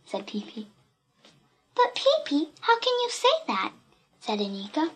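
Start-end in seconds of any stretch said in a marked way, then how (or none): 1.7-3.7 put-on high-pitched, incredulous girl; protesting child. A narrator's reading. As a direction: object in a much higher voice. Child protests friend's claim.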